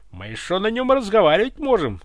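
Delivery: ordinary delivery, nothing marked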